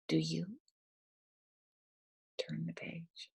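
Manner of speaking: ordinary delivery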